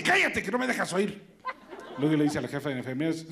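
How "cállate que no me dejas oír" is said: doctor scolding. Acted. Doctor scolding baby in joke.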